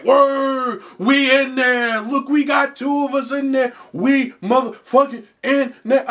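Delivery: hyped fans cheering, half mocking; mock celebrating. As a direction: ham up a crowd celebrating. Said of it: "people celebrating, like".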